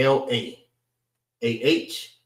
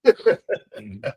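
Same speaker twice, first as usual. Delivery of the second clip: short chuckling burst of laughter; amused laugh; 2 semitones higher than usual. Real, not acted. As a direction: laugh it off briefly. Amused at his own clip remark.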